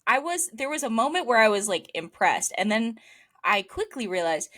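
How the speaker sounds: normal talking voice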